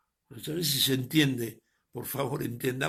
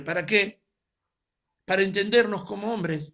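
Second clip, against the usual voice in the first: loud, higher preacher's emphatic answer; rising preaching; 7 semitones higher, 6 dB louder than usual. Real, not acted. Pastor answering his own question for the church, loud and high. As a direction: lift your voice and drive it home.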